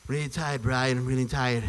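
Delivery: exhausted Stewie. Pretend. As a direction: drag the words out like a spent little Stewie. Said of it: "some exhausted Stewie".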